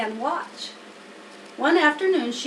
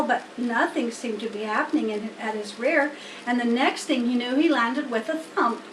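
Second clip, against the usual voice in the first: plain reading voice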